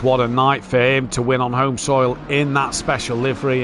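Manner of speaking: ordinary talk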